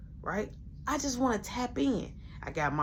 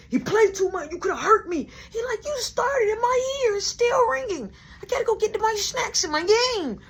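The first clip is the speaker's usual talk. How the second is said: whiny little brother; 13 semitones higher, 3 dB louder than usual. Retold or imitated. Brother complaining after fall.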